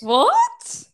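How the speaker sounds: startled, shrill disbelief; shocked high what. Real, not acted. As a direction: gasp it out in shock. Reacting to shocking voice note.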